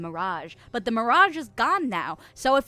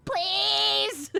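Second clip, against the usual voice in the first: high, whiny begging that climbs; mock pleading; 8 semitones higher than usual. Streamer begging chat to like the jokes, mock pleading. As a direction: beg in an exaggerated needy whine.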